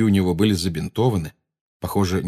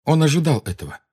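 ordinary delivery, nothing marked

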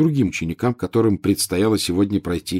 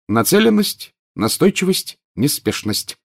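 ordinary delivery, nothing marked

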